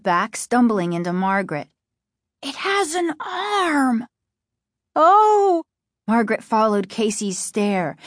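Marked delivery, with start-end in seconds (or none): 2.4-5.6 high, drawn-out wail of alarm; frightened high-pitched exclamation; horrified cry. A narrator's reading. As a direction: cry out in horror. Boy horrified by plant arm.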